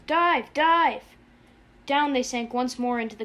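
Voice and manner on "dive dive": high, loud urgent order; two sharp frantic yells; screamed command. A narrator's reading. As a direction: scream it to the pilot. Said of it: "to McWatt he screamed".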